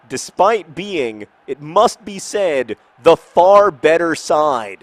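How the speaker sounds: slow, loud, high-pitched indignation; indignant. Real, not acted. Gamer protesting being down two one, indignant. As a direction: drag out each phrase in loud protest. Losing game, indignant complaint.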